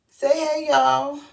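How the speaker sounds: put-on high, loud sing-out for the baby; baby talk. Mother has her newborn greet viewers, high baby talk.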